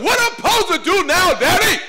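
put-on high, loud little boy calling up to the stands; kid calling to dad. Son on the field calling up to daddy, high and loud. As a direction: ask it like a lost little leaguer.

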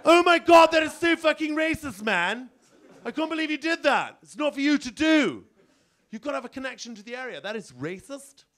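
shocked and scolding, voice up high; high mock-outraged snob; outraged Indian elephant. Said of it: "the African elephant says to him".

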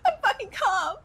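high, exasperated protest; angry insistence. Pretend. Still insisting he is calm.

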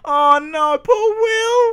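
high, wailing pity; mock crying. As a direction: whine it in hammed-up grief. Mourns dead character.

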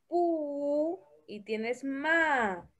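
very slow, stretching PU and MA; slow sounding-out. Shown as a demonstration. Teacher prompts Christopher to read, slow and drawn out. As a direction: spell it out syllable by syllable, slowly. Teacher repeating the two syllables.

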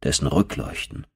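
ordinary delivery

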